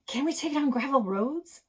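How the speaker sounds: put-on bright eager child's question; eager kid voice. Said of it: "I asked".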